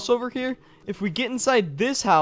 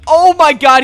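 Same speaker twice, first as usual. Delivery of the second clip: loud, higher-pitched alarm; panicked shout; 4 semitones higher, 11 dB louder than usual. Pretend. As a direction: cry out as the intruder enters. Intruder coming inside.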